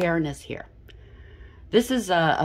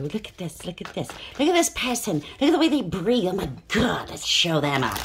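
mock scandalized; 4 semitones higher than usual. Onlookers pointing at a person to show them up, mock scandalized. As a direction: play an onlooker pointing someone out in outrage. Voicing people pointing someone out.

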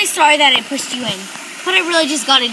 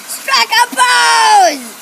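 diva call; 7 semitones higher, 6 dB louder, much slower than usual. Acted. Posing call.

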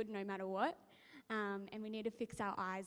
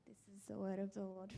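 liturgical closing; 3 semitones lower, 5 dB quieter, faster than usual. Acted. Closing formula of reading.

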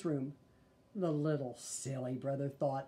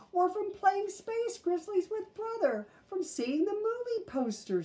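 put-on little girl voice; 4 dB louder than usual. Sister adds eagerly.

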